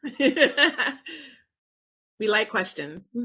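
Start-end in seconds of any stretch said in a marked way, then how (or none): 0.0-1.0 laughing echo of the word. Real, not acted. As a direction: say it through a laugh.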